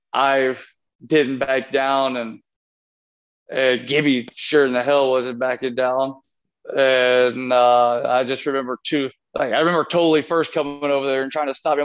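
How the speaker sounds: ordinary talk, nothing marked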